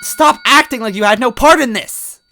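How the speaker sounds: put-on high, loud desperate girlish yell; Toadette yelling. Toadette yelling at Smithy over her brother. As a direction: cry out for her lost brother.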